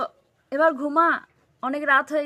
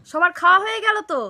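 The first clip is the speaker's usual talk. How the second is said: calling out; 4 semitones higher, 8 dB louder than usual. Acted. Calling child to eat.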